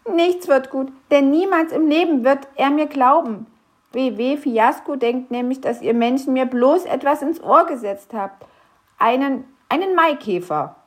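a small creature sure nobody will believe him; despairing little grump. Acted. Knöterchen despairs about his boss. (höhere, klagende Wichtelstimme)

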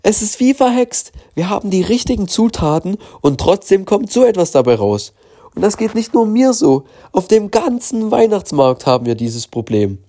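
high-pitched, despairing market seller; desperate seller, high. Acted. Seller complains to the family, high and despairing.

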